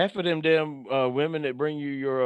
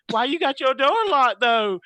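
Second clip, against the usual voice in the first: high, drawn-out whine of a complaint; mock complaining; 9 semitones higher, 4 dB louder than usual. Friends in adjoining rooms grumble about locked door, mock complaining. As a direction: imitate a nagging friend, pitched up and slow. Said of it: "they'll start complaining though".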